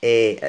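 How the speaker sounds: "إي" as a loud open eh; sideways open vowel. Shown as a demonstration. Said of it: "الفم يفتح جانبًا".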